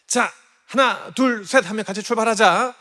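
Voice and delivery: eager countdown. Retold or imitated. Dad counting his daughter off on her bike, eager and loud. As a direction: cheer her off like a proud dad.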